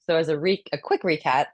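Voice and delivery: normal talking voice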